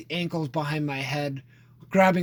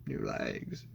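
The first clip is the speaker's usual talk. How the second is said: very low, soft, deep growl; Lieutenant Dan impression; 14 dB quieter than usual. Friend answers a Forrest Gump joke, very deep Lieutenant Dan. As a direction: gravel it like the bitter veteran. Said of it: "Lieutenant Dan".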